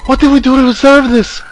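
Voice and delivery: put-on high, loud girlish wail; desperate scream. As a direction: cry out hysterically in a put-on girl's voice. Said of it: "She screamed again, a desperate, mindless cry".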